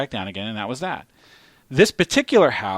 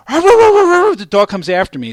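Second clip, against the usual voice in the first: higher, louder, slow alarm as the dog charges; alarmed telling; 12 semitones higher, 9 dB louder, slower than usual. Retold or imitated. Telling friends the dog charged, loud alarm. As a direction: relive the moment it lunges.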